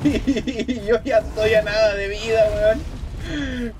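slow, high, laughing in despair; laughing disbelief. Real, not acted. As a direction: laugh through it, can't believe it. Boss has second phase, low health.

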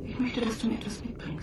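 breathy close whisper to Alexander. Acted. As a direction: hush it as if nobody may overhear. Sprecherin flüstert durchgehend. (geflüstert)

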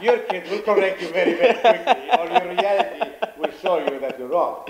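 amused, giggling warning; laughing. Real, not acted. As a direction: say it through a laugh. Laughing through remark.

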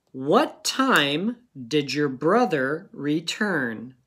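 what time did your brother return said slowly, every word clear; slow dictation again. Shown as a demonstration. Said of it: "go ahead and pause it".

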